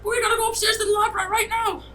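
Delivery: very high, squeaky panicked voice; a tiny goblin babbling his excuses; flustered little creature. Acted. Kruk flustered apologizing, tiny creature.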